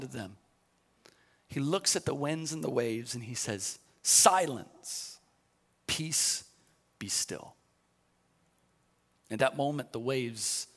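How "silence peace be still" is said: calm command. Retold or imitated. Jesus calms the storm.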